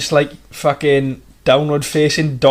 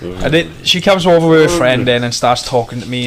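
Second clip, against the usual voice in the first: usual speaking voice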